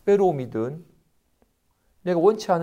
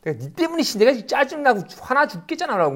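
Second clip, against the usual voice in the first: high, louder irritated complaint; imitated angry blame; 10 semitones higher, 5 dB louder than usual. A frustrated person blaming another, high mock angry complaint. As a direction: vent frustration at someone. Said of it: "괴로워합니다".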